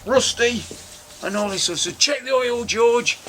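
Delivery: mock salesman patter. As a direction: ham up a dodgy dealer's spiel. Mocking the car salesman's patter.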